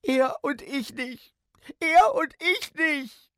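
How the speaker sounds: high, drawn-out wail, slowly wailed; acted child's sobbing, rising in volume. A narrator's reading. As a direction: cry it out slowly like a heartbroken kid. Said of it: "und schluchzte".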